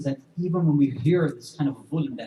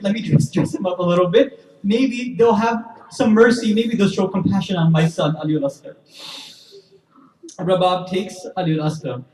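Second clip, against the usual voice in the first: louder, higher preaching at full force, building grief; impassioned preaching; 7 semitones higher, 9 dB louder than usual. Acted. Preacher to the mourners, telling Ali al-Asghar's story, impassioned loud preaching. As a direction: drive the lament home to the congregation.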